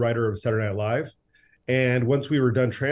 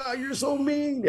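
high whiny voice; 4 dB quieter than usual. Retold or imitated. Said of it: "and he's like".

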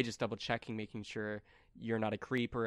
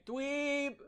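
put-on kid calling out; 13 semitones higher, 4 dB quieter than usual.

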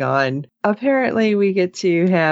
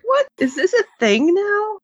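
disbelief. Retold or imitated. Reacting in disbelief to the show.

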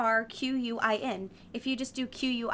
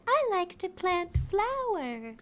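put-on high, slow dreamy girl voice; mock a dreamy ditz; mocking airy voice; 8 semitones higher, slower than usual. Mocking airy Elaine quote.

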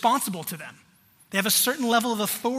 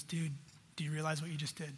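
hushed friend; 4 semitones lower, 11 dB quieter, faster than usual. Retold or imitated. Friend pulls him aside quietly.

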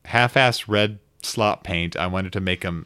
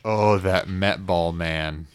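ordinary talk

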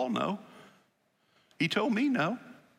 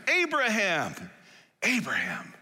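God calling; 8 semitones higher, slower than usual. Acted. God calling Abraham twice.

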